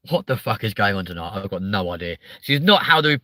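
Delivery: usual speaking voice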